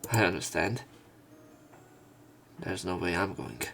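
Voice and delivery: ordinary talk, nothing marked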